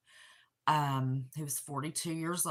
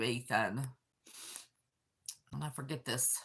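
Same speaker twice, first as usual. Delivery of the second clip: usual speaking voice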